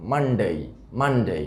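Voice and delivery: মানডে ending in a gliding "dey"; full ei diphthong closing মানডে; correct pronunciation. Shown as a demonstration. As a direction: say মানডে as "MUN-day". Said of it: "ডেটা ডেই হয়ে যাবে".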